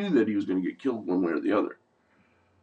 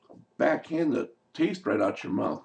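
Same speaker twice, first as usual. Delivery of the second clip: ordinary talk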